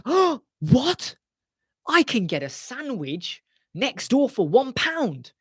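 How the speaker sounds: high, mock-indignant gasp at the price; mock outraged customer. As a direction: play a customer shocked by the price, slowly. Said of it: "you're like".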